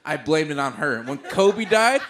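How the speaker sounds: ordinary delivery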